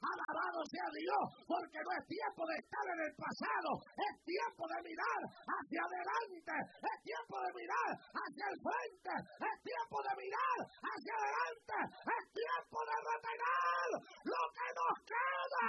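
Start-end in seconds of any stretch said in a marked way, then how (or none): 3.9-9.2 rising, loud, insistent repetition; emphatic preaching. Real, not acted. Preacher pressing the congregation, building emphasis. 9.2-15.7 high, loud cry breaking through; crying shout. Real, not acted. Preacher at the climax urging them to hold on, crying shout.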